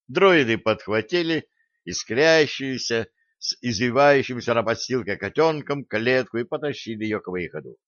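ordinary delivery, nothing marked

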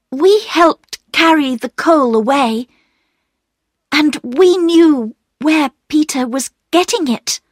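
put-on high-pitched girl character in a read-aloud; confessing Bobby. A narrator's reading. Bobby admitting her part to the station master, high-pitched and earnest. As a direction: own up in a high child's voice.

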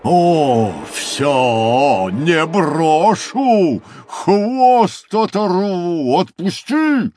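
loud, stretched, rising shouts; threatening rhinoceros. Acted. Rhinoceros hauling crocodile's tail, loud rising threats. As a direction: bellow slowly, climbing in pitch.

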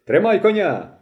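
високий гучний крик; гукни з хати на двір. A narrator's reading. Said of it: "знову крикнув він". (гучний високий окрик)

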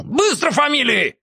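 high squealing demand; squealing sergeant. A narrator's reading. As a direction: squeal it out sharply. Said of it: "и взвизгнул".

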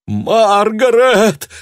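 high, loud, slow pained cry. A narrator's reading. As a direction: cry out her name in pain. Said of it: "больше боли, чем торжества".

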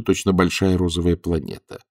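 ordinary delivery

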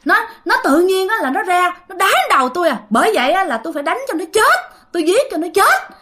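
high-pitched retold grievance and threat; possessed father complaining. Possessed father justifying his rage to ông Tám, acted aggrieved threat. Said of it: "Nói vậy các bạn".

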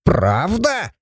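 high, drawn-out rising question; put-on dwarf voice, keenly interested; intrigued drawl. A narrator's reading. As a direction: stretch it with delighted curiosity. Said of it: "заинтересованно протянул Абаддон".

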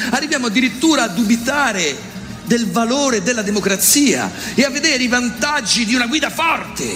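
ordinary delivery

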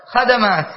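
خدمات as kha-da-māt, a in both syllables; mistaken a vowels. Shown as a demonstration. Teacher quotes the common mistake, خدمات as kha-da-māt. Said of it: "فخدمات هذه ليست جمعا لخدمة".

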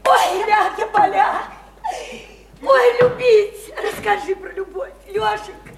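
high, drawn-out gushing; high-pitched, swooning enthusiasm; gleeful begging. Acted. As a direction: coax him excitedly to talk of love. Women gleefully beg for love talk.

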